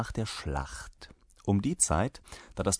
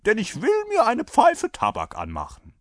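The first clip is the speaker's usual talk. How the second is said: loud, high-pitched soldier voice; unbothered rider; 13 semitones higher, 6 dB louder than usual. A narrator's reading. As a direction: declare your wish brazenly. Rider insists on his pipe. (dreister Reiter, laut und hoch)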